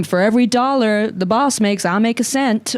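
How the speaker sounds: put-on a light American accent, half in jest; leaning into a playful mock American accent. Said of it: "I'm doing an American accent".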